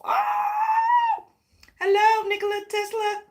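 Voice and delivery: high shouted oh, then hello; excited. Real, not acted. Realizing Tesla fits her idea. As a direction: burst out with the discovery.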